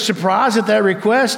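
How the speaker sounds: ordinary talk, nothing marked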